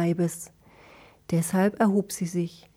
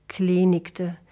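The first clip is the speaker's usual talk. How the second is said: ordinary delivery, nothing marked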